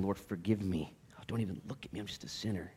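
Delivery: self-abasing. Retold or imitated. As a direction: hang your head and beg quietly. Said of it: "beating your own breast".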